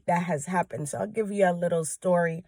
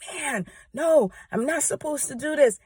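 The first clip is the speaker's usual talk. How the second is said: higher-pitched retelling of her own inner protest; inner panic; 7 semitones higher than usual. Retold or imitated. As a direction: replay the frantic thought in your head, pitched up. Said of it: "in my mind".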